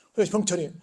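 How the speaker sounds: ordinary talk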